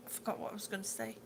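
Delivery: fast unvoiced mutter; mumbled aside. Real, not acted. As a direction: mutter it to yourself.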